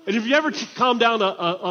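usual speaking voice

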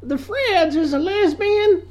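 very high, slow, sing-song; hammed-up mom; shrieking gossip mom. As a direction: wail it like a church gossip. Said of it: "his mom's like".

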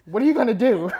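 play-acted exasperated question; alarmed questioning. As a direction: demand an answer in mock alarm. Acted nervous questioning of God.

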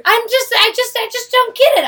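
sputtering, exasperated; frustrated. Real, not acted. [name] venting about her husband, frustrated. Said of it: "I'm really frustrated".

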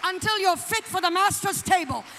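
loud, punched sermon warning; full-force preaching. Real, not acted. Preacher warning about praying grandmas, forceful preaching. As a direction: hammer each phrase to the congregation.

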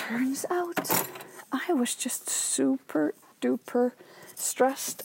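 whispered, breathy confession; hushed aside. Real, not acted. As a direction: drop to a whisper as if sharing a secret. Confiding an aside in a hush.

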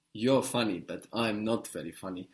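"You're" hit harder, "I'm" stressed in contrast; emphasis by voice. Shown as a demonstration. Teacher shows English emphasis with voice, stressing "You're" and "I'm". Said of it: "we will emphasize with the voice".

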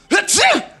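hammed-up sneeze, high and loud. Patient sneezes in the middle of describing symptoms, hammed-up loud sneeze. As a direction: let a big fake sneeze burst out.

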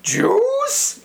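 "Juice?" squeaked very high and rising; high squeaky "Juice?". Retold or imitated. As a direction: squeal "Juice?" as a shocked question. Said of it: "I said it like that".

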